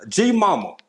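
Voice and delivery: high, loud call of the name; excited shout-out. Real, not acted. Host greeting a favourite viewer with a loud high call. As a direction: belt the name out in joy.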